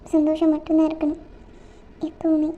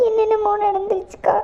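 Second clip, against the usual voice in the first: ordinary talk, nothing marked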